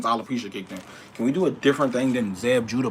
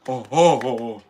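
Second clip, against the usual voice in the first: ordinary delivery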